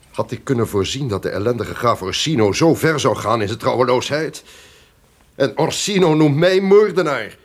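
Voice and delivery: rising fury, the last word hurled out; indignant outburst. Acted. The duke rails at Orsino's treachery, furious and loud.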